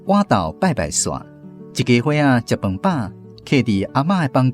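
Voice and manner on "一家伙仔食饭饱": ordinary delivery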